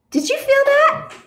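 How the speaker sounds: high, loud startled question. A narrator's reading. One bird feels the egg move, startled question. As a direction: pitch it way up, suddenly alert.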